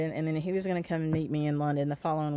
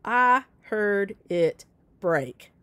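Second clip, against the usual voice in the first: slow, drawn-out exasperated protest; mad, hurting; 2 semitones higher, 3 dB louder, much slower than usual. Retold or imitated. Injured wife protesting to husband, slow and exasperated. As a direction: drag each word out at him. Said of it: "I was mad 'cause I was hurting".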